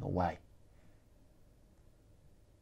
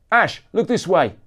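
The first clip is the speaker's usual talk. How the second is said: loud, higher call across a crowd; 7 semitones higher, 8 dB louder than usual. Acted. Photographer calling to the rock star, loud call. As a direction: shout to catch his attention. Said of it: "called a photographer".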